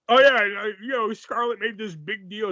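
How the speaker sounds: usual speaking voice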